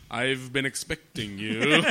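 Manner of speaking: slow, deliberate villain line; slow theatrical villain menace. Shown as a demonstration. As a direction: savour each word like a Bond villain. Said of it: "look him in the eyes and go".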